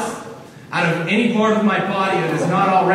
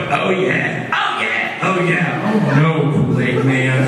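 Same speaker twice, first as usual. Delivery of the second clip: booming mascot chant; sing-song Oh yeah like the mascot; Kool-Aid Man impression; 4 semitones lower, 3 dB louder than usual. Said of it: "he's dancing around going".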